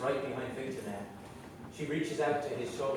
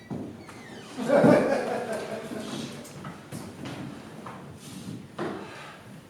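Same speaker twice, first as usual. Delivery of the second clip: breathy laugh; 10 semitones higher than usual. Real, not acted. Laugh during scene.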